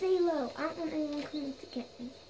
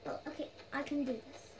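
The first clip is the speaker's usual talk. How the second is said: ordinary delivery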